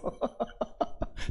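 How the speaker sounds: short real laughter; amused laugh. Real, not acted. Pastor on the half-finished door, short amused laugh. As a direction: laugh at the half-done job.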